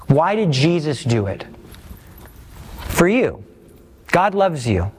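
usual speaking voice

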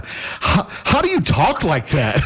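slow, awed, slightly dopey question; clueless student. Retold or imitated. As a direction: play your tongue-tied younger self, laughing at him. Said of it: "this is kinda where I was at at the time".

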